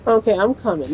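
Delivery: gently reassuring the little one. Real, not acted. Baby wants picking up.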